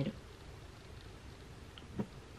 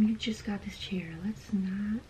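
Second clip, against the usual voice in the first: unvoiced whispered aside; 5 dB quieter, slower than usual. Real, not acted. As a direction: whisper it to yourself. Warning aside to herself.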